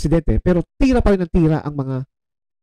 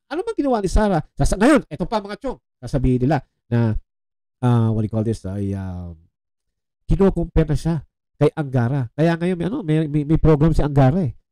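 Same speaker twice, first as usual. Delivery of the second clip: ordinary talk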